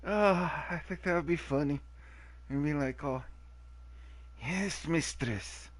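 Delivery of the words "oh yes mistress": slow, high servile impression; Igor. Player playing servant to a mistress, hammed-up slow voice. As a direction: drag it out as an obedient henchman. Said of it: "That was my Igor impression".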